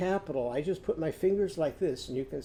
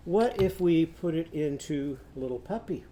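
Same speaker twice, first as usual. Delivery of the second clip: normal talking voice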